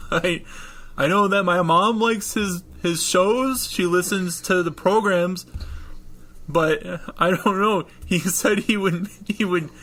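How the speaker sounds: put-on high, boyish voice with halting pauses; a kid nervously explaining himself in a high voice; young boy.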